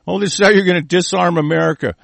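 ordinary talk, nothing marked

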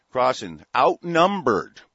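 outnumbered said slow, high and loud; dramatic emphasis. Real, not acted. Host tells of Washington's crossing with a loud, slow, rising outnumbered. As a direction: land the word outnumbered like a battle cry. Dramatic climax of Trenton story.

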